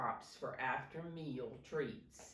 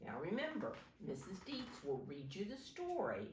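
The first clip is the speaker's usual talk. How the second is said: plain reading voice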